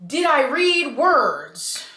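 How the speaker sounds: high, drawn-out bewildered question; incredulous. Acted. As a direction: stretch it out in mock disbelief. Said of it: "I'm like".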